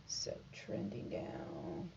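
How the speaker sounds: slow low whisper. Real, not acted. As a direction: hush it out slowly. Soft repeated aside.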